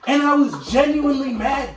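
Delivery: high and loud, laughing through the words; laughing story. Real, not acted. Telling his friend about the dream, laughing hard. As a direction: crack up while confessing the dream.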